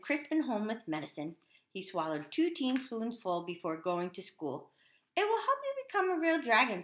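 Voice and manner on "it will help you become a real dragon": high, quick playful line; father's encouragement. A narrator's reading. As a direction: rattle it off playfully to your son. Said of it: "said his father with a wink".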